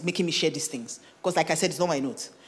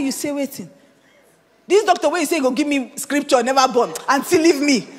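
incredulous outburst; 5 semitones higher, 7 dB louder than usual. Retold or imitated. Retelling her outburst to friend.

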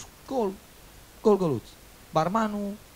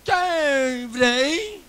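strained mute voice; 10 semitones higher, 6 dB louder than usual. Acted.

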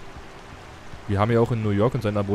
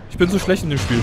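frustrated outburst; 8 semitones higher, 7 dB louder than usual. Real, not acted. Frustrated gamer complaint.

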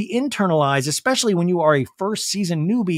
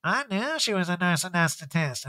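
softer, offhand imitated Erica; imitating Erica; 5 dB quieter than usual. Host imitates Erica's soft offhand remark to the group. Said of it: "And Erica's like".

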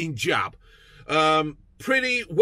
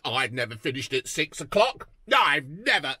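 mocking Rishi Sunak; 6 semitones lower than usual. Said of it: "you're talking of, like".

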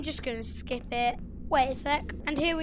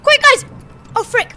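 much higher, much louder frightened shout; panicked yell; 11 semitones higher, 16 dB louder than usual. Real, not acted. Gamer fleeing the monster, panicked high yell to viewers. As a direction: yell in real panic as it chases you. Chased by Music Man.

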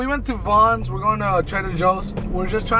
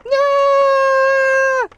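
high, loud cheer; excited shout; 5 dB louder than usual. Real, not acted. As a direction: yell it out in excitement.

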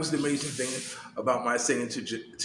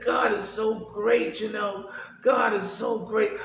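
joyful praise; 10 semitones higher, 3 dB louder, slower than usual. Real, not acted. Praising God at daughter's call.

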